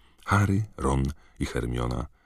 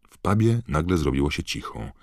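ordinary talk, nothing marked

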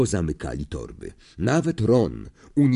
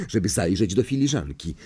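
ordinary delivery

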